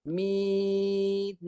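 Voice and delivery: "Min" with a brief nasal hum on the nun; teacher showing a light ghunnah; short nasal ghunnah. Shown as a demonstration. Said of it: "sikit saja untuk menyempurnakan bunyi nun".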